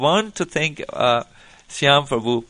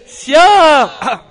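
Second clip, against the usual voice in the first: loud, high devotional call, falling at the end; enthusiastic cry; 12 semitones higher, 11 dB louder than usual. Real, not acted. Teacher crowning the Radhe chant, calling out enthusiastically. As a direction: call the holy name out with full-voiced fervour. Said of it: "I'm getting a little enthusiastic".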